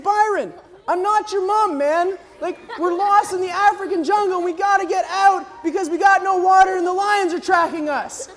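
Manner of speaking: high, exasperated retold outburst; urgent pleading. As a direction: shake sense into a groggy friend. Urgently arguing with his dazed friend.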